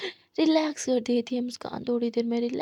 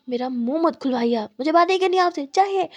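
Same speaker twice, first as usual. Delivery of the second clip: angry outburst; 2 semitones higher, 8 dB louder than usual. Acted. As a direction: yell it in a rage. Angry girlfriend snaps.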